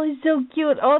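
normal talking voice

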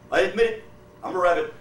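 a hint of a cowed bear; beaten bear confessing. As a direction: plead it like a battered bear.

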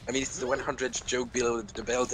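ordinary talk

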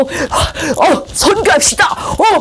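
high mock shout with gasps; carried away excited. Zealot proposing missions without praying first, giddy shout. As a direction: burst out, thrilled all by yourself. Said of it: "혼자 신나가지고".

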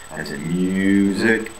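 As in music, spoken slowly; teacher modelling the U sound; slow vowel demo. Shown as a demonstration. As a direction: drag out the you in music. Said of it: "the Y U phonetic symbol".

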